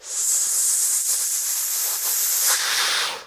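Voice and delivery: long, slow breath out; drawn-out airy exhale. Pretend. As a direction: blow out smoke at length. Puffing along with the viewers.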